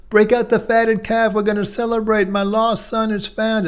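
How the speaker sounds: father ordering a feast for his lost son, overjoyed; joyful father. Acted. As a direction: call out the feast with joy.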